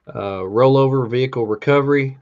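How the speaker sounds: ordinary talk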